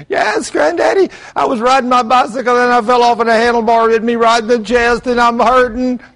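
put-on high, loud hurting little boy; crying child. Grandson cries to grandpa about his bike fall, high and loud. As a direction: play the sobbing grandson. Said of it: "Preston just screaming at the top of his lungs".

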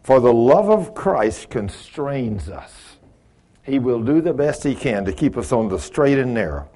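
usual speaking voice

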